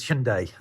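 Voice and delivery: "Hyundai" said SHUN-day; two-syllable "Hyundai", ending in "day"; Korean pronunciation. Shown as a demonstration. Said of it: "The Korean pronunciation is".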